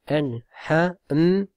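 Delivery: "al-ha-m" split slowly into parts; syllable by syllable. Shown as a demonstration. Teacher spelling out the word for students. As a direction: break "al-ha-m" into beats.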